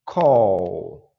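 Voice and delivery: "Call" dito alto e claro para a turma repetir; model word. Shown as a demonstration. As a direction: anuncie "Call" forte e pausado. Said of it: "Olha o segundo verbo". (professor modelando "Call" em voz alta)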